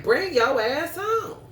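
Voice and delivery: high, loud mock scolding; mock yelling mom. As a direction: bark it like an angry mother. Mother yelling in retold scene.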